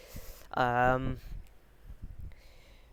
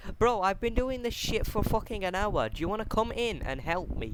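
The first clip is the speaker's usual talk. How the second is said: real exasperation; 8 semitones higher than usual. Real, not acted. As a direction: tell off the interrupter, exasperated. Snapping at brother interrupting the recording.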